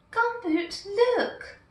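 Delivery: high, excited calling out. A narrator's reading. Little girl calling Gumboot to look, excited. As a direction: point and call to him eagerly. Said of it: "the little girl was calling".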